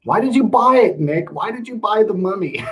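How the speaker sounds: high-pitched, exasperated questioning; mock dismay. Streamer asking Nick why he bought the Mummy, in mock dismay. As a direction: demand an explanation in a high, mock-pained voice. Mock-scolding a viewer's purchase.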